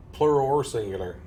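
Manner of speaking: usual speaking voice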